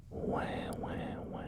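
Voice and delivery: put-on whispered baby wails; mock baby crying. Joking that he's a crybaby to viewers, mock whispered wah. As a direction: fake a baby's cry in a whisper. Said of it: "Baby crying A S M R".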